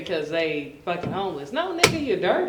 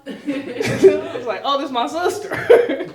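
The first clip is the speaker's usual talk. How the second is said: laughing; 5 semitones higher, 8 dB louder, slower than usual. Retold or imitated. Retold realization.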